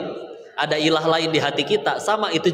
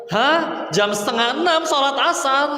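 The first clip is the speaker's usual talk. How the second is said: much higher, incredulous Hah; shocked disbelief; 12 semitones higher, 3 dB louder than usual. Acted. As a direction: gasp in disbelief at the late prayer. Aunt shocked at child's answer.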